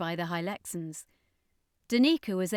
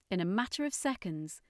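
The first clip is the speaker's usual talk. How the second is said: plain reading voice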